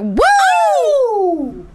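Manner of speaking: high, loud mock wolf "Woo!"; mock excited woo. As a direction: yelp it like an overexcited wolf. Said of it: "What it was, was a wolf cry".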